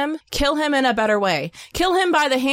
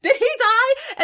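high, loud disbelieving shout; shocked shout; 3 dB louder than usual. Retold or imitated. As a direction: yell it in shock at the screen. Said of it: "sorry, that got loud".

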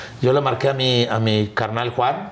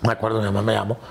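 normal talking voice

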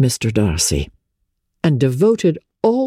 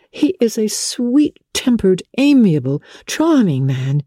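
put-on higher young woman's voice, slow and fond; Elizabeth, admiring; 7 semitones higher than usual. A narrator's reading. Elizabeth to Wickham, praising Bingley, slow higher admiring voice. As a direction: linger warmly over his good qualities.

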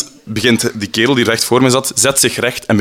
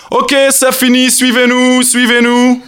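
high, loud commanding voice; stern order; 14 semitones higher, 4 dB louder than usual. Retold or imitated. Harasser revealing the experiment, loud high command. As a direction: order them off like a cop. Undercover man ends the experiment.

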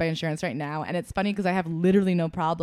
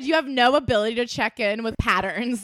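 ordinary talk, nothing marked

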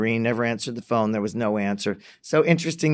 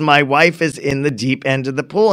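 normal talking voice

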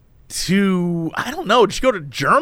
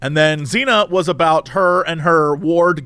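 ordinary talk

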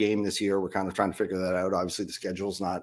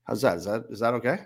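ordinary talk

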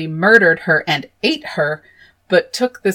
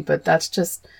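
normal talking voice

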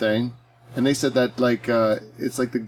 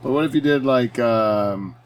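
ordinary talk

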